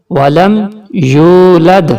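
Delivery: یولد with the و held long; stretched vowel. Shown as a demonstration. Teacher showing the stretched واو. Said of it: "کھینچ کے پڑھا جائے گا".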